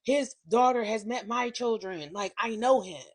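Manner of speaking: ordinary talk, nothing marked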